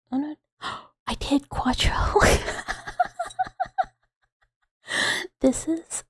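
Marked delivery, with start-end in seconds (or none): 2.0-3.8 high-pitched, long burst of laughter. Real, not acted. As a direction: crack up at your own mistake. Cracks up at own miscount.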